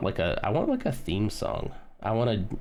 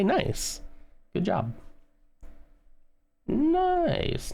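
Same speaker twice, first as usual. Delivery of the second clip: squeaky self-praise; much slower than usual. Pretend. Praising herself in high voice.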